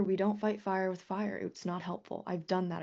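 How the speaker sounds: ordinary delivery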